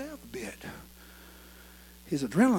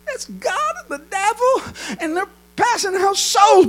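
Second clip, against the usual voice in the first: high, drawn-out frightened cry; panicked boy; 12 dB louder, slower than usual. Acted. Boy believes God and devil divide souls, panicked high cry. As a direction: jump up into a scared child's pitch. Boy terrified by graveyard voices.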